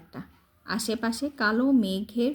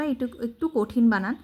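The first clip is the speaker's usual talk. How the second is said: ordinary talk, nothing marked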